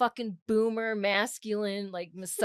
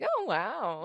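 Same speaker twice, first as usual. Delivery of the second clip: mock impressed; 3 dB quieter than usual. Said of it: "I'm starting to do impressions".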